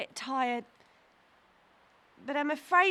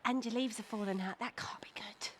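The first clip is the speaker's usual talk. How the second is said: hushed aside whisper; worried little whisper; 6 semitones lower, 8 dB quieter, faster than usual. Acted. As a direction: whisper it so the tree won't hear. Girl aside about the oak.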